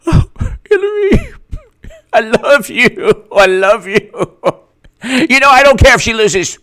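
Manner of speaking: high, laughing swoon; mock gushing. Mock fan greeting Hillary, high giddy swoon.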